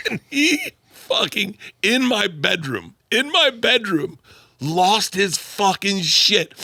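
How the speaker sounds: high, drawn-out storytelling emphasis; slow dramatic build. Real, not acted. Son recounting dad's blowup in his bedroom, slow dramatic build. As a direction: stretch each phrase out, pitched high.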